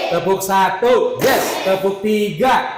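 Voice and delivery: rally the pupils with clap calls; energizer game leader. Real, not acted.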